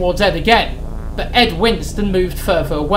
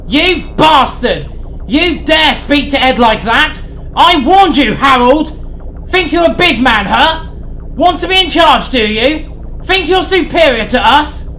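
much higher, louder furious shouting; enraged yelling; 8 semitones higher, 5 dB louder than usual. Acted. Sid rages at Harry in the well. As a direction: roar at him to break him. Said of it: "Sid was enraged".

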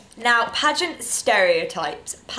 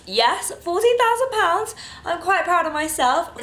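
proud excitement; 7 semitones higher than usual. Real, not acted. Proud of charity total.

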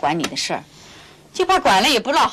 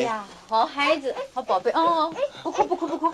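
put-on softly coaxing, pitched up like to a baby; hushing a child; 6 semitones higher, 4 dB quieter than usual. Aunt cradling the crying boy, soft and coaxing. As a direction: rock him quiet with your voice.

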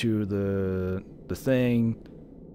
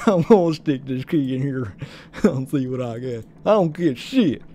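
hammed-up southern accent; 5 dB louder than usual. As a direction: play the clueless southerner, accent laid on. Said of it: "over the southern accent".

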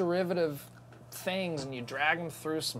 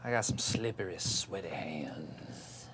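hushed, slow creepy whisper fading to breath; a mock-sinister whisper; 11 semitones lower, 9 dB quieter, slower than usual. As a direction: drop to a sly whisper on sweaty hands. Creepy aside to co-host.

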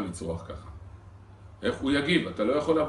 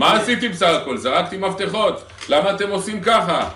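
mock offended man; 5 semitones higher, 6 dB louder than usual. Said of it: "תתביישו לכם".

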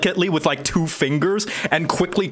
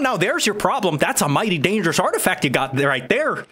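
lively, higher-pitched wizard warning; folksy wizard; 6 semitones higher, faster than usual. Acted. Wizard warns party about dangerous artifact, folksy voice. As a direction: swoop up and down like a wary old-timer.

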